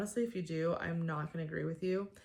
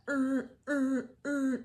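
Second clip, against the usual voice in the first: loud, higher blaring alarm noise; imitated alarm; 6 semitones higher, 5 dB louder, slower than usual. Shown as a demonstration. Vlogger mocking harsh alarms with a loud blaring imitation. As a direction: blare it like a military alarm. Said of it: "crazy alarm sounds".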